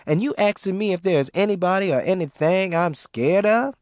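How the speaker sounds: lightly voiced brassy mama, boastful; a hint of a swaggering tough mother; Mama Ruby voice. A narrator's reading. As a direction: brag you fear nothing.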